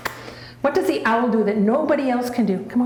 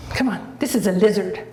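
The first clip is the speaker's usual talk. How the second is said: usual speaking voice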